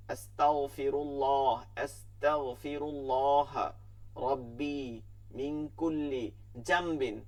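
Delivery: আস্তাগফিরুল্লাহ slowly, long vowels held; careful recitation. Shown as a demonstration. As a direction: draw out each word of আস্তাগফিরুল্লাহ রাব্বি. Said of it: "এরকম আমাদেরকে খেয়াল করতে হবে". (ধীরে টেনে তিলাওয়াত)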